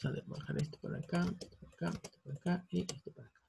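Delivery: very soft, quick, half-unvoiced murmur; talking to self. Real, not acted. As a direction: mumble it under your breath, hurried. Muttering to self while moving text.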